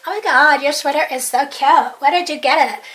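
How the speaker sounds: put-on American accent, full of admiration. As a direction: imitate an American stranger's gushing compliment. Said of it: "American accent that's like that".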